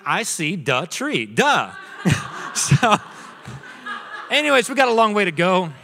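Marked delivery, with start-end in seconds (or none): 0.0-1.7 "duh" for "the", spoken slowly; child's mispronunciation. Retold or imitated. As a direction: spell it out slowly, "duh" as if obvious. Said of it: "Use it in a sentence for me".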